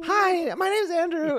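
very high, loud squeaky self-introduction; hammed-up falsetto hello; mock high voice. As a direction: exaggerate a piping high frequency. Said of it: "frequency down by, like, ten points".

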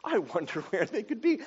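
a teasing put-on puzzled voice; mock clueless God. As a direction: pretend to wonder, tongue in cheek. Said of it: "He's not actually like".

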